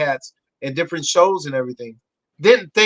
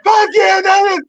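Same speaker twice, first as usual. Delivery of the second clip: high, loud triumphant shout; acted fan cheer; 6 dB louder than usual. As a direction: cheer like a hyped-up viewer. Acts out viewers' cheering.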